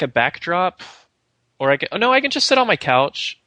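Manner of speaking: ordinary delivery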